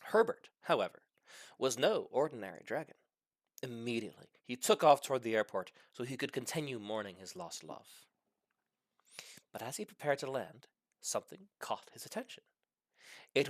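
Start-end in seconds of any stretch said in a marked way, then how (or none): none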